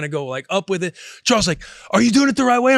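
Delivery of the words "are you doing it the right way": high, urgent retold question; anxious questioning. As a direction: imitate a worried parent checking mid-rescue. Anxious mother questions the Heimlich.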